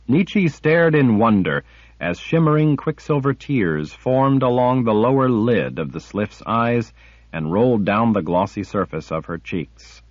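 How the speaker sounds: usual speaking voice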